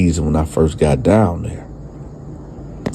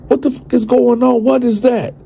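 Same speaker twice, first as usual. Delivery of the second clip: shocked outburst. Retold or imitated. Shocked at strange scene retold.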